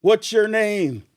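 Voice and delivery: loud, slow, high call through a megaphone; mock megaphone yell. Officer on megaphone calls up to the house, yelling slowly. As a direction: bark it out like a cop on a megaphone. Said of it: "he yells out".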